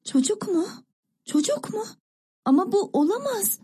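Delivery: put-on high, shocked little-girl questioning; startled disbelief. A narrator's reading. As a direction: gasp it out high, unable to believe it. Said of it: "Küçük kız birden irkildi".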